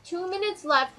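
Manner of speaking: lightly voiced teacher nagging the class; imitating a teacher. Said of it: "your teacher's like".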